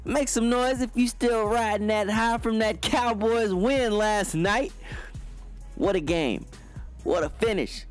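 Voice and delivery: big hyped show-host intro; booming motivational speech. Acted. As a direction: pump up the crowd like an announcer. Host opens his sports show.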